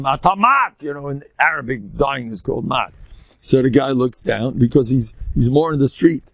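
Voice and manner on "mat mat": raised-pitch mock yell; storyteller's half-shout; imitated yell. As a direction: call it out as a retold warning. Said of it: "he yelled at him".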